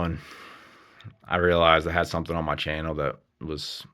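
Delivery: ordinary talk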